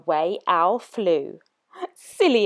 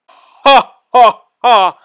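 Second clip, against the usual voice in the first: loud booming monster laugh; mocking laughter; 2 semitones higher, 9 dB louder, slower than usual. Gruffalo laughs at the mouse, loud and booming. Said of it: "bursting with laughter".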